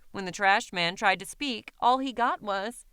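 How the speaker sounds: usual speaking voice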